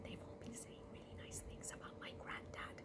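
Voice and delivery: very soft church whisper; whispered gratitude. Acted. Cat to Rat during the service, whispering. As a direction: whisper thanks without disturbing the service.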